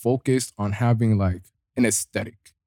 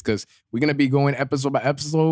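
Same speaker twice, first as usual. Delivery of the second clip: ordinary talk, nothing marked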